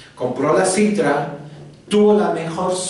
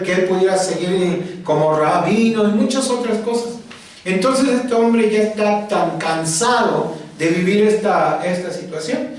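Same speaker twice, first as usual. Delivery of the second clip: ordinary talk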